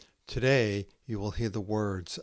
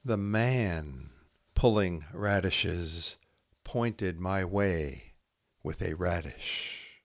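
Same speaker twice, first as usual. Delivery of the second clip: slow haiku reading with long pauses; poem reading; 7 semitones higher, slower than usual. Acted. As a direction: let each image hang in the silence.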